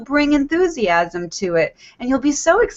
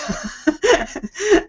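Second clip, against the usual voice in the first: a short laugh, higher than her talk; amused laugh; 7 semitones higher than usual. Real, not acted. Coach laughs at her old dish-washing habit, amused. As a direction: laugh at yourself.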